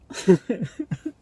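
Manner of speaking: high, loud laugh; manic laugh. Acted. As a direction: burst into crazed stage laughter. Manic laughing inside soliloquy.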